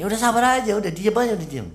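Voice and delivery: high-pitched, impatient hushing; mock scolding. Acted rebuke to the complainer.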